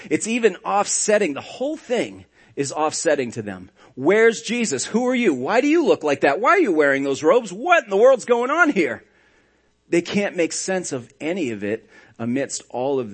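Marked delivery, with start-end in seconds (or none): 4.0-9.0 bewildered questions. Retold or imitated. Women confused at the tomb.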